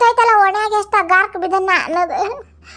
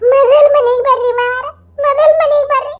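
louder, higher tearful begging; crying plea; 9 semitones higher, 5 dB louder than usual. Acted. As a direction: beg him through sobs to come home. Begging father-in-law to return. (ಅಳುತ್ತಾ ಜೋರಾಗಿ ಬೇಡುವುದು)

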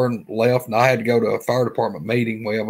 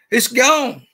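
startled friend; 10 semitones higher, 3 dB louder than usual. Retold or imitated. Friend sees creature vanish.

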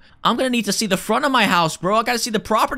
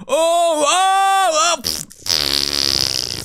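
hammed-up toilet strain; rising groans ending in a raspberry; 7 semitones higher, 4 dB louder, much slower than usual. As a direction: push it out with loud, high grunts. Play-acted toilet session, straining.

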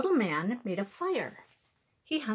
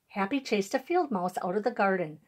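ordinary delivery, nothing marked

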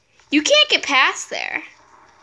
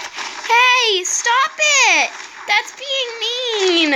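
高い声の抗議; 意地悪なドラゴンをたしなめる; whiny protest; 5 semitones higher, much slower than usual. Real, not acted. Scolding the dragons.

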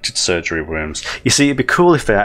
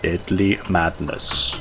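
put-on softer, slower German-accented repeat of "Deadly madness"; Herzog echo; 2 semitones lower, 4 dB quieter, slower than usual. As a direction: let "Deadly madness" sink, quiet and heavy in a German accent. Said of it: "I can't do his accent".

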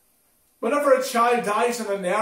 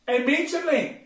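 urgent command; 6 semitones higher, 5 dB louder than usual. Retold or imitated. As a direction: answer the urgency with a firm, raised voice. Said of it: "Jesus saw the urgency".

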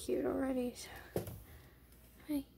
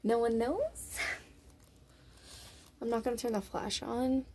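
ordinary delivery

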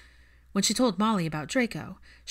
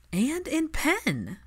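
high, very slow mock horror; horrified; 9 semitones higher, much slower than usual. A narrator's reading. Hermione appalled at Draco's pen crossword, drawn-out horror. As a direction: stretch it out, appalled. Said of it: "Hermione's horrified tone".

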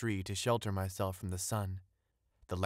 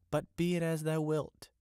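mild exclamation, pitched higher; crying out; 6 semitones higher than usual. A narrator's reading. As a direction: concede with a lifted voice. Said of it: "cried the young fisherman".

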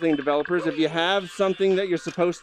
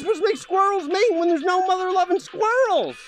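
exasperated outburst; 12 semitones higher than usual. Real, not acted. Exasperated rant at the game.

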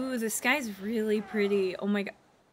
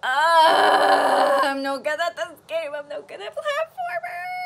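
high wailing cry, half-joking; mock crying wail; 6 semitones higher, 7 dB louder than usual. Real, not acted. Failing gamer wailing at herself in mock despair.

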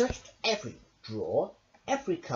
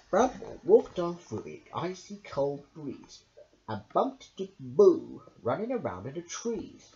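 ordinary delivery

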